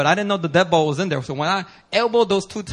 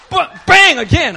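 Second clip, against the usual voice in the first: louder and higher, drawn out; loud slow bang; 10 semitones higher, 11 dB louder, slower than usual. Retold or imitated. Pastor telling the congregation about the second elbow hit, loud and slow. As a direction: slam the word out like the hit.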